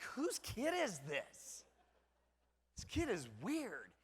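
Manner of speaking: put-on slow, bewildered musing over a strange kid; drawn-out mock confusion; wondering aloud whose kid this is; puzzled parent.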